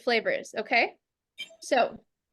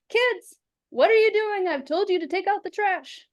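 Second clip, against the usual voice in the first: put-on yelling at kids; 6 semitones higher than usual. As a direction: scold the kids from across the house. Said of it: "I yelled at my kids".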